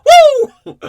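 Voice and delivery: a huge, high, loud whoop; whooping woo. Retold or imitated. Joking about a woo nobody forgets, huge whoop. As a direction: let out a wild party whoop. Imitating [name]'s memorable woo.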